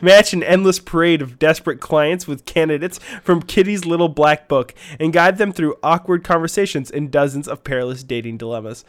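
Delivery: loud, hyped-up promo announcement; announcer read. Acted. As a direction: announce it as the big headline act. Announces game of the year.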